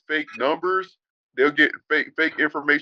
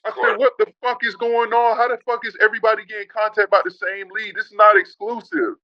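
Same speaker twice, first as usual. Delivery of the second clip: reliving the rage on the phone; retold angry outburst; 8 semitones higher than usual. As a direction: re-enact the furious call, voice jumping up. Retold outburst at lead company.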